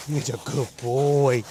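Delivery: gentle slow praise for the dog; soft praise. Real, not acted.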